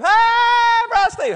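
high excited shout across the room; loud call. Retold or imitated. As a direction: call him out loud like an eager six-year-old. Replaying the eager kid shout.

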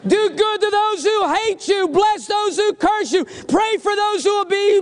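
high, insistent preaching. Real, not acted. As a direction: pound out each phrase high and sustained. Preaching at full force.

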